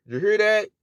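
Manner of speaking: ordinary talk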